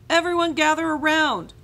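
very high, loud call to the group. A narrator's reading. Camp leader calling the kids to the treasure hunt, loud high call. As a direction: shout across the camp to gather everyone. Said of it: "called Lizzie".

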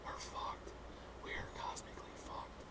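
hushed doomsday whisper; dramatic whispered dread; ominous whisper. Acted. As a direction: whisper it like a horror secret. Dread whisper about eternity.

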